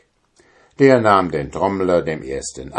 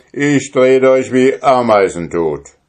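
threatening giant; 6 semitones higher, 6 dB louder than usual. A narrator's reading.

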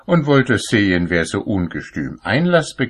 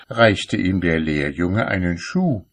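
ordinary delivery

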